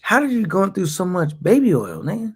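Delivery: normal talking voice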